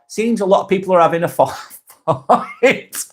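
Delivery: chuckling through the last words; amused, laughing. Real, not acted. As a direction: let a real laugh interrupt the sentence. Amused by bickering in chat.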